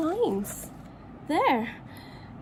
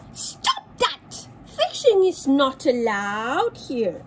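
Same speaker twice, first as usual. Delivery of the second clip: bossy duck; 3 dB louder than usual. Acted. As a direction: scold the kingfisher. Duck scolds kingfisher.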